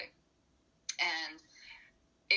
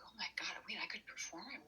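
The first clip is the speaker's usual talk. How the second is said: excited hushed whisper, softer; a secret thrilled whisper; 2 semitones higher, 8 dB quieter than usual. Retold or imitated. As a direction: breathe it out in disbelief. Sudden excited realization, retold.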